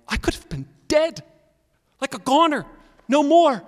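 high-pitched, wide-eyed disbelief; stunned realization. Acted. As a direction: blurt it out, reeling from a near miss. Acted realization of near death.

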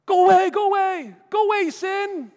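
high shrieking go away; mock Smeagol. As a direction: mimic a creature shooing sin. Mocking easy fix.

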